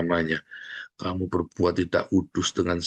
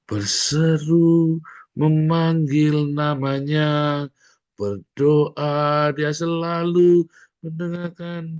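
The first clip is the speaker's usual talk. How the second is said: high, slow cry to God; 6 semitones higher, 4 dB louder, much slower than usual. Shown as a demonstration. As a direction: call out long and high. Preacher cries out demo. (berseru tinggi dan lambat)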